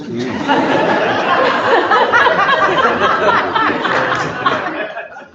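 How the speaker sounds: long high helpless laughter; high laughing burst. Real, not acted. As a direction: let the laugh take over. Laughing at the absurd story.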